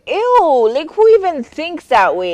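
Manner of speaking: high, loud "ew" of revulsion; disgusted. Real, not acted. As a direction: recoil in disgust, pitched high. Disgusted exclamation at husband's insinuation.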